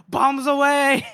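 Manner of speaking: loud, high, drawn-out holler; mock battle cry. Man curled in a trench awaits the blast, loud mock cry. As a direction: call it out like a hammed-up bombardier.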